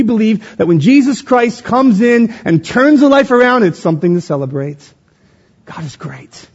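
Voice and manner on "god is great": soft, low near-whisper; hushed reverence. Real, not acted. Preacher to church after celebrating, hushed reverence. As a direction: let it drop to a reverent whisper.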